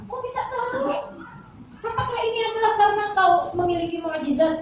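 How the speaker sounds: high-pitched, wondering question; amazed questioning. Acted. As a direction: act out a wife's astonishment. Aisyah questions the Prophet in story.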